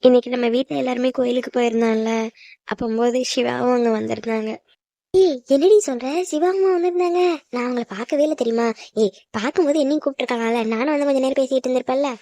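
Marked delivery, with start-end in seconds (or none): 5.1-7.2 high, eager questioning; excited surprise. Acted. Sister hears that Shiva was there, excited surprise. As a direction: perk right up at the news.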